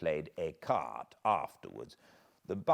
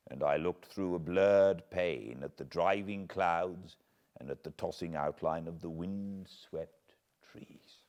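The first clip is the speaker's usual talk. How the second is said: plain reading voice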